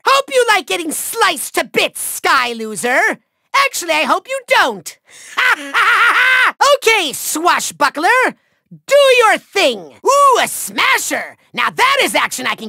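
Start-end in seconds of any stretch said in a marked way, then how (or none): 5.4-6.7 evil cackle. Acted. Villain mocking the trapped Skylander, gloating cackle. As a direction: cackle in triumph.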